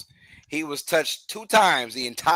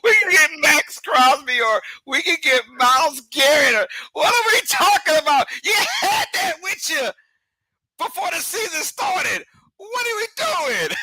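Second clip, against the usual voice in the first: exasperated shout; 13 semitones higher than usual. Real, not acted. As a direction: yell it like you can't believe the nonsense. Mocking the Cowboys' trade talk.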